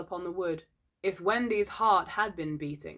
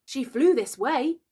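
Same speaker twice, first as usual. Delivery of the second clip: high, slow, loud insistence; becoming troubled; 8 semitones higher, 6 dB louder than usual. A narrator's reading. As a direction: press them with growing concern. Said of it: "becoming troubled".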